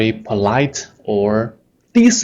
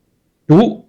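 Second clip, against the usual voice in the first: loud, high 读 snapped; an angry parent commanding 读; 9 semitones higher, 5 dB louder than usual. Shown as a demonstration. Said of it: "you can feel the anger".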